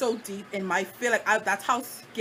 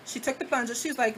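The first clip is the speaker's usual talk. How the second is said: ordinary talk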